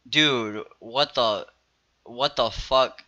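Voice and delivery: low, soft, slow dumbfounded mutter; quietly floored by the stranger's offer; stunned disbelief. Retold or imitated. Quiet stunned reply to creep.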